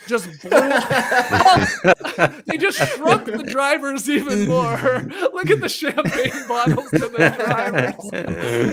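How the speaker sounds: cracking up over a funny picture; words stretched out through giggles; laughing while talking. Real, not acted. Cracking up over an edited photo.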